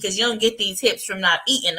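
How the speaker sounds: ordinary talk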